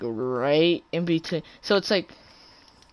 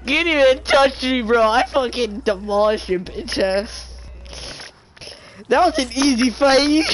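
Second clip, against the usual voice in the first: gloating; 6 semitones higher, 4 dB louder than usual. Real, not acted. Taunting the beaten opponent.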